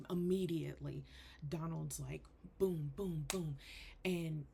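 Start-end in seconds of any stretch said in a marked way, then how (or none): none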